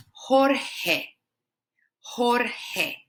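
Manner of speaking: Jorge as HOR-heh, pure E end; Spanish pronunciation. Shown as a demonstration. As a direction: Jorge with short final E. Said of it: "the E is just E".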